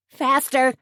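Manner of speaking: high, urgent shout; urgent yell. A narrator's reading. Tony in the car urging Suzanne to outrun the Jeep, yelling. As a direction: yell at the driver to hurry. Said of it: "Tony yelled".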